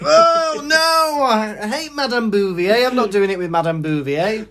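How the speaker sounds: high, loud wail of dread; hammed-up pupil's horror, easing down; mock dismay. Pupil learns who teaches GCSE French, mock dismay.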